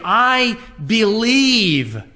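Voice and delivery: slow, ringing confession of "I believe"; emphatic declaration. Acted. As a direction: declare it slowly, leaning hard on "believe".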